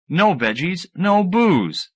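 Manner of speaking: ordinary delivery, nothing marked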